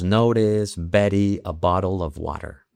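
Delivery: "Notice", "Betty", "bottle", "water" with tapped Ts; American flap T. Shown as a demonstration. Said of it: "tendemos más a la flat T".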